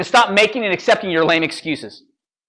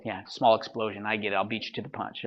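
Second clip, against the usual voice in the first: much softer, flat know-it-all mutter; muttered mock dismissal; 7 semitones lower, 12 dB quieter than usual. Speaker voicing a bored listener who thinks he knows it, softly. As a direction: mumble it like a bored skeptic. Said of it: "The old".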